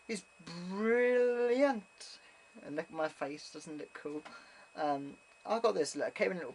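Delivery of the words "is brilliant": high, drawn-out rave; gushing praise. Real, not acted. Reviewer praising the London Dungeons, gushing. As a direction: stretch the word with delight.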